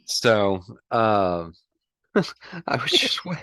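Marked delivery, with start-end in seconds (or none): 2.1-3.4 breaking into giggles mid-sentence; real giggling while talking; laughing. Real, not acted. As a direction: laugh through the words, higher. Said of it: "tickled me so bad".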